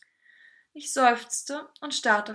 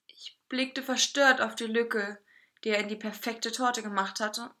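ordinary delivery

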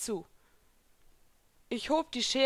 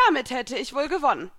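loud, raised triumphant claim; sure of victory; 6 semitones higher, 6 dB louder than usual. A narrator's reading. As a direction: declare it, certain you have won. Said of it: "sagte Elsa siegessicher".

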